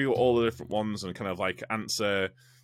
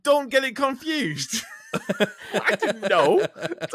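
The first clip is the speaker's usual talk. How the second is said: higher, laughing through the words; 11 semitones higher, 5 dB louder, slower than usual. Real, not acted. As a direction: laugh it off in disbelief. Laughing at the mix-up.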